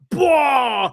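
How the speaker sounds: high, loud explosive "bwah"; exaggerated sound effect. Imitating cousin describing things with sound effects, loud blast. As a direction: blast it out as a comic noise. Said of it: "when she's describing things like".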